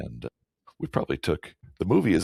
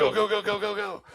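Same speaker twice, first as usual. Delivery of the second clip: very high pretend urging; mock urgent cheer. Host joining in as the guys on ropes, high mock urging. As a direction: squeal it like a tiny frantic crew. Joins in voicing the sled crew.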